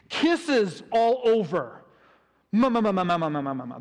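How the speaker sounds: loud smacking kisses, hammed up; playful mock kisses for the children; kissing sounds. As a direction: smother him with noisy kisses for the kids. Dad kissing son, acted for kids.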